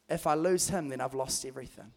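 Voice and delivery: soft, hushed earnest words; quietly firm through grief; quiet conviction. Retold or imitated. Grieving mum to dad, hushed earnest vow. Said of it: "She said".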